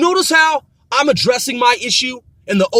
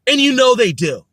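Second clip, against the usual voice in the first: ordinary delivery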